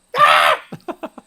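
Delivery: high, loud laughing burst; loud burst of laughter. Real, not acted. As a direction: crack up loudly. Joke about the sneezing moon.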